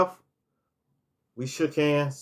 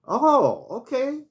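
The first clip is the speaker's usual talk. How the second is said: much higher put-on voice of the man; the man surprised he's hotel manager, much higher put-on voice; imitating him, surprised; 10 semitones higher than usual. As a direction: play his mild surprise, pitch well up. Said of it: "He said".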